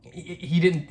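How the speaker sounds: usual speaking voice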